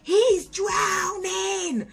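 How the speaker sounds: very high, long-drawn holler; urgent retold shout. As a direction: holler a warning, stretching every vowel. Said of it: "I shout".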